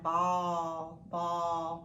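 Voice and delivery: "ball" with a long, drawn-out aw vowel; stretched vowel sound. Shown as a demonstration. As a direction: hold the aw in "ball", low and even. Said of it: "The ah sound".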